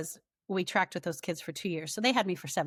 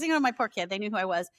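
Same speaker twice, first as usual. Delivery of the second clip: ordinary talk, nothing marked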